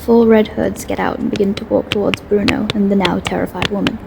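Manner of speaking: ordinary delivery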